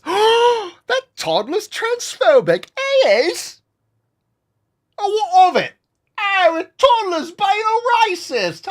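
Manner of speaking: very high, crying mock hysteria; hysterical adult. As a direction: wail it like a shocked parent. Hysterical adults voiced in skit.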